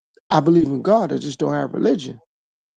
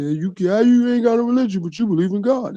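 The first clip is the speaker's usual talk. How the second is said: higher, challenging voice; mocking questioner; 5 semitones higher than usual. Imitating critics of his faith, higher and challenging. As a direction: ask it like a doubting critic. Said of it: "I'm saying".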